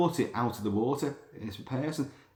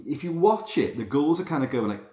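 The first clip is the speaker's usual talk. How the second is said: normal talking voice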